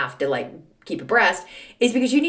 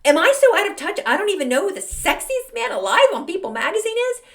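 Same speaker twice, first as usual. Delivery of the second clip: high, louder panicked self-question; alarmed disbelief; 11 semitones higher, 4 dB louder than usual. Retold or imitated. To herself about the sexiest man alive, in high alarmed disbelief. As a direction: gasp at your own ignorance, loud and high. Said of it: "I go".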